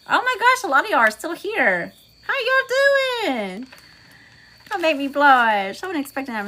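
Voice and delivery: high-pitched, stretched-out excited welcome; delighted surprise. Real, not acted. Surprised greeting to viewers still present.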